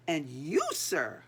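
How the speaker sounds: single out the culprit in rage; angry accusation. Acted. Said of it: "purple with rage".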